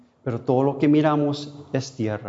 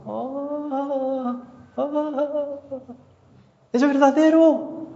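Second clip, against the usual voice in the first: anguished cry; 13 semitones higher, much slower than usual. Acted. Preacher cries out in lament.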